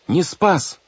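high-pitched, urgent protest; crying out. A narrator's reading. Girl contradicts the captain before the banker, crying out. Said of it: "закричал Вайолет".

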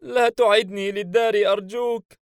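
put-on high, loud tearful child voice; crying child plea. Child begs Hayim not to return him, crying plea. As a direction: plead as a little boy bursting into tears. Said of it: "بدأ الطفل الصغير البكاء".

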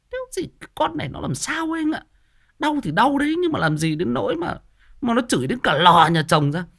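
high-pitched imitation of a disapproving wife; frowning disapproval. Wife frowning at another woman's labour cursing, disapproving. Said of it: "vợ em còn cau mày bảo".

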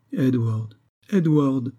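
mild mock sob on his name; understated tearful plea, rising on the repeat; sobbing. A narrator's reading. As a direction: call his name with a touch of tears. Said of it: "dit-elle en sanglotant".

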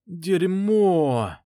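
a loud, high groan of dismay; despairing groaned curse. Acted. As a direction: moan it out in despair. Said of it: "простонал Инжи".

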